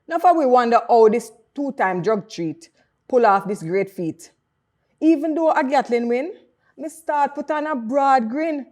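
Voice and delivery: loud, high, proud Jamaican Patois poem recitation; poem recital in Patois. Acted. Poet reading her Patois tribute to Bolt, proud and loud. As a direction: declaim it like a tribute to a hero. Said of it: "we can hear what it sounds like".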